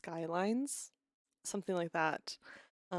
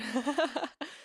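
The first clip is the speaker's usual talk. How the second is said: short burst of laughter; laughing at her own joke; 8 semitones higher than usual. Real, not acted.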